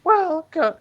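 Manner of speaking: high put-on falsetto; girl voice. Persona inviting her summoner, high falsetto girl voice. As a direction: start brightly as a squeaky fake girl. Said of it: "Is that really your best girl voice?".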